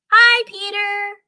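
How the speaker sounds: put-on high, sung little-kid voice; singsong child greeting. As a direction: chirp it like a small child singing hello. Children greeting Peter in story.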